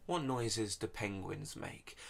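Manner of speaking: ordinary delivery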